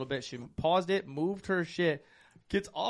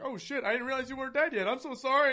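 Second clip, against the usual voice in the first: high talking gun voice; 9 semitones higher than usual. Retold or imitated. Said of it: "he's like".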